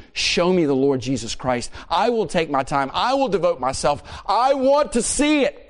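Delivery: rising, impassioned plea, climbing to a high peak; earnest plea. Acted. Seeker asking the Almighty to show him Christ, building earnest plea. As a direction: plead with growing insistence, peaking on the last phrase.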